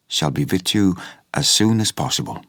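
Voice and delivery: ordinary delivery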